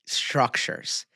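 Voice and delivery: normal talking voice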